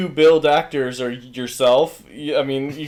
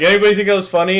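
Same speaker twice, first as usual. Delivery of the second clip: mock crowd question; 4 semitones higher, 6 dB louder, much faster than usual. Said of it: "everyone around him is like".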